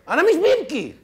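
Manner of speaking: indignant protest. Acted. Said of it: "طب انت ببكي".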